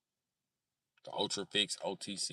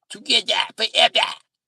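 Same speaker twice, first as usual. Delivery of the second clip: put-on French accent; 11 semitones higher, 8 dB louder than usual. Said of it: "like doing the French accent".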